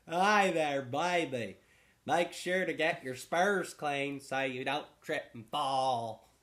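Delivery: loud, slow, hammed-up Southern drawl; trailer park Southern accent. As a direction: play a cowboy from a trailer park, slowly and loudly. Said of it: "It's like middle of the trailer park".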